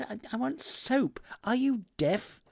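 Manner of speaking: high-pitched exasperated protest. A narrator's reading. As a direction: pitch it right up, fed up with not being heard. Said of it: "By now my voice had matched his volume".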